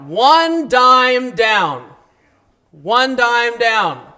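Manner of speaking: slow, drawn-out New York car-ad pitch; hint of a New York accent selling cars; mock New York ad. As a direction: ham up a New York car salesman, slowly. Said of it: "horrible New York accent".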